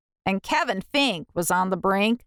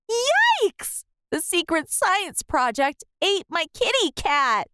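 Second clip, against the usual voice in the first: high squealing yikes, then alarmed; panicked kid, much higher; 7 semitones higher than usual. A narrator's reading. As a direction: shriek it in shock. Child panics at cat eaten.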